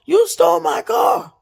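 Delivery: high shouted accusation; the man shouting that the stolen car is his; crazed shout. Retold or imitated. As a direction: yell it like he's lost his mind. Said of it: "He's like".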